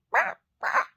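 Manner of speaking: loud, high raven caws; two sharp mock raven squawks; imitated raven cackle. Ravens answer the rooster, imitated cackling caws. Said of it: "cackling back at him".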